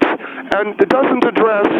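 ordinary delivery

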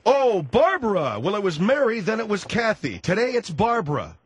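scornful, exaggerated sing-song recap; sarcastic. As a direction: list the changing names with open disbelief. Host mocks caller's changing answers.

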